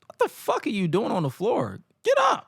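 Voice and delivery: mock yell, voice jumping high. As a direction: imitate a mama yelling him up off the floor.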